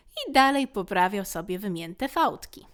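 ordinary delivery

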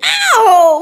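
loud, high cry of pain; sudden yelp. Acted. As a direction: shout as the claws hit. Cat scratches someone.

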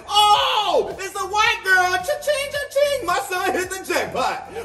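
high, cheering hammed-up dad; excited dad. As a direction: cheer like you hit jackpot.

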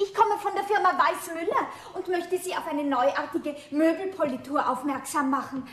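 loud announcing to an empty room; loud sales call. Acted. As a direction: project the pitch so someone upstairs hears. Saleswoman calling into empty shop.

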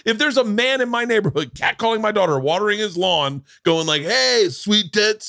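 high-pitched imitation of a fired-up dad; mock outraged buddy. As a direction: rant high, like a buddy itching for a confrontation. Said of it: "my buddy starts going".

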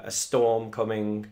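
usual speaking voice

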